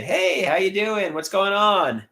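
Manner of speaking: cheerful hello that lifts as it goes; friendly and confident. Shown as a demonstration. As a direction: greet them like an old friend. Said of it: "try to be friendly".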